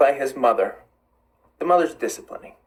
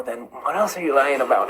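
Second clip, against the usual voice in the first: ordinary talk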